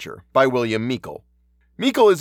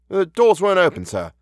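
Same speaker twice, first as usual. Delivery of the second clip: constable's simple, higher-pitched answer; simple reply; 7 semitones higher than usual. A narrator's reading. Constable answering his sarcastic inspector, simply. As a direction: answer artlessly, voice lifted in pitch. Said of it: "said the man simply".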